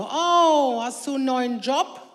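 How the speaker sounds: loud sarcastic oh, teasing question; mock surprise. Mother teases her son about not signalling, loud and sarcastic. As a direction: feign amazement to tease him.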